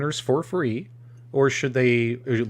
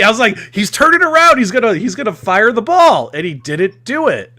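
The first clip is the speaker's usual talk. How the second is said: worked-up rant; 8 semitones higher, 7 dB louder than usual. Real, not acted. Worked-up rant about missing gameplay.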